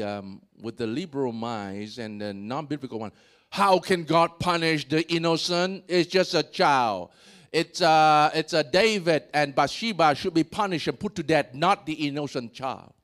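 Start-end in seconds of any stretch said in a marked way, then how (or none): 3.5-12.9 louder, protesting reader; mock outrage at the punishment; indignant objection. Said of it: "Some of you like reading with the".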